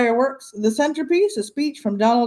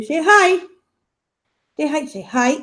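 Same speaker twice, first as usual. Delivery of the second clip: high pet voice; 6 semitones higher than usual. Real, not acted. Urging puppy to greet viewers.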